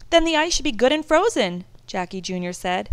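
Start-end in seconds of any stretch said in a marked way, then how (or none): 0.1-1.7 put-on high, loud eager boy; eager kid. A narrator's reading. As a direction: argue it brightly like an excited boy. Eldest son arguing eagerly.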